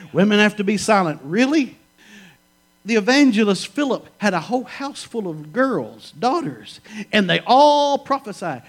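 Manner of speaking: loud, high, driving sermon voice; preacher rousing the congregation on women prophesying; full-force preaching. Acted. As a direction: preach it to the back row.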